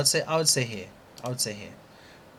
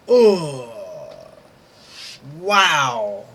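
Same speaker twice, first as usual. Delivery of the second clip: anguished cry; 3 semitones higher, 6 dB louder than usual. Real, not acted. Reacting to missed profit.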